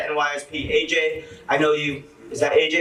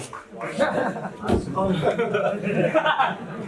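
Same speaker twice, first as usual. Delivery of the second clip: laugh; 5 semitones higher than usual. Real, not acted. Nervous laugh at speech start.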